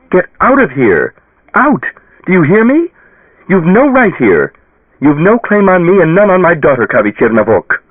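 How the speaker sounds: very high, loud, commanding shout; furious banishing. A narrator's reading. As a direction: order the spirit out with fury. Mother banishing the ghost.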